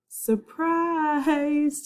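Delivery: high, drawn-out mock cheer; spring the joke on him; boy teasing his friend over gross lunch, high and gleeful; teasing surprise. A narrator's reading. Charlie teases about the meatloaf.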